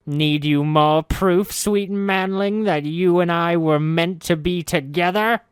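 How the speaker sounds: mocking him with sweet words; high witch voice teasing her captive; gloating witch. Witch Ravel taunts.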